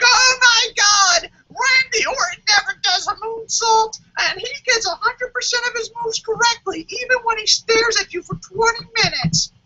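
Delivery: complaining fan overdoing the drama; whiny internet fan. Retold or imitated. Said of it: "And they're like".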